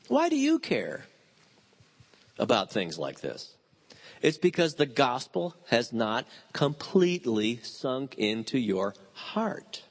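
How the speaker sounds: normal talking voice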